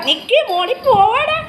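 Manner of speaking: high-pitched call to a pet; playful calling. Real, not acted. (ഓമനിച്ച് ഉയർന്ന സ്വരത്തിൽ വിളിച്ച്)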